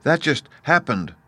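put-on high, artless child's voice; simple little girl. A narrator's reading. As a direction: answer simply, like a young girl. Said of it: "returned Dorothy simply".

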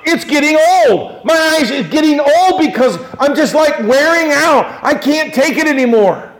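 high, shouted despair; David. Acted. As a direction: cry out as if worn out by grief. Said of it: "That's what David is saying".

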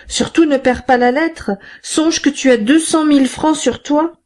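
appel lancé bien plus aigu dans le couloir; crié dans le couloir. A narrator's reading. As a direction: crie la consigne par la porte entrebâillée. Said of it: "et cria dans le corridor". (Thénardier crie à sa femme dans le couloir, voix aiguë)

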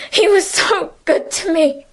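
the second time the grief breaks through; voice breaking into tears. A narrator's reading. Emily to Francine, breaking down into tears. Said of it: "burst out crying".

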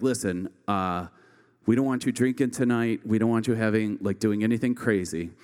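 a hint of a caring parent laying down the rules; mild mock parental lecture; parent's speech. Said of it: "your parents would give you this speech".

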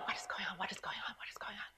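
breathless whispered panic, over and over; panicked whisper. Retold or imitated. Mother alone with sleeping son, whispering in panic. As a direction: whisper it to yourself in alarm. Panicked inner thought, heart pounding.

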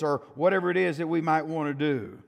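loud, rising pulpit preaching. Acted. Preacher warning the church against defying God, in full preaching voice. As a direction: preach it hard to the congregation.

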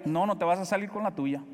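ordinary talk, nothing marked

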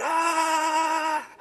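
long, high-pitched scream; re-enacted scream of terror. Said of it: "she hears me screaming".

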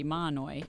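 "Mahanoy" run together as MAH-noy; local pronunciation, silent H. Shown as a demonstration. Reporter confirming the tricky name, "Mahanoy" with silent H. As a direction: say "Mahanoy" without its H, two syllables. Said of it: "a silent H".

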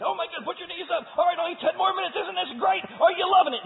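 very high, loud fitness instructor hype; mock an overexcited workout coach; hyped trainer. Preacher mocks the insanity workout trainer, hyped and shouting. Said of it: "this guy who is so excited".

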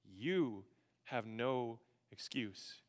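low, slow, hushed pronouncement; grave verdict. Acted. Paul answering the protesting readers, grave slow verdict. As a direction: lay down each word slowly and softly.

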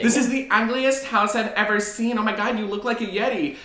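high, snooty, exaggerated disgust; mocking high voice. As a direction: camp it up, high and scornful. Mocking hosts judging a house.

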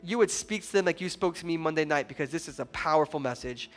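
soft, quiet pastor's prayer; hushed opening prayer before the sermon. Real, not acted. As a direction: pray over the congregation softly.

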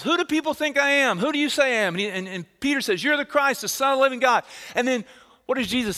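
loud, high, ringing sermon voice; full-force preaching. Acted. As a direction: drive the story home to the congregation.

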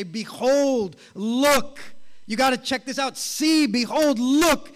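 urgent wonder, pointing the crowd at something amazing; astonished. Acted. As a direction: cry it out as if you can't believe your eyes. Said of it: "It's this phrase of astonishment".

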